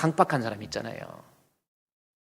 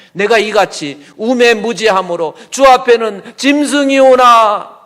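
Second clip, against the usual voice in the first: loud slow recitation; 4 semitones higher, 9 dB louder, slower than usual. Acted. Reading Asaph's confession.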